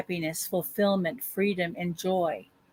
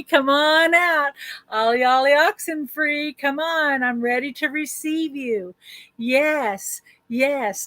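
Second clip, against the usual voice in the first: playful calling out; 4 semitones higher, slower than usual. Pretend. Calling money to come out of hiding, playful sing-song shout. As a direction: call out like a kid ending a game of hide and seek.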